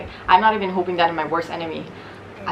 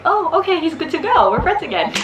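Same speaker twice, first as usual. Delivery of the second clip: mock cheerful; 8 semitones higher, 3 dB louder than usual. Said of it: "I'm like".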